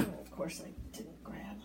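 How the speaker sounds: muttered whisper to herself. Real, not acted. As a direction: whisper it as an aside. Realizes she forgot something.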